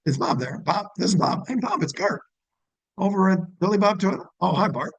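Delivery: bright, high friendly greeting as if he knows you; familiar tone. Shown as a demonstration. Dealership rep calling Bob like an old acquaintance, friendly and familiar. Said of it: "that tone would be".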